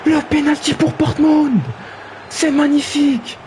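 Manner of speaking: high, ecstatic commentator cry; excited commentary. Acted. Commentator hailing the penalty for Portmund, ecstatic high call. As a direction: sell the penalty like a huge moment.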